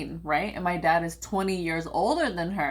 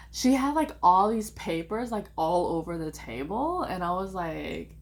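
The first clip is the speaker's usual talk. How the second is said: normal talking voice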